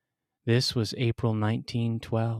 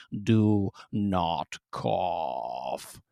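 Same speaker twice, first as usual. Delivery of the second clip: very slow, low, looming warning; menacing mother; 4 semitones lower, much slower than usual. Acted. Towering mother leaning down to threaten her son, slow and low. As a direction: lean down and drag out each word with menace.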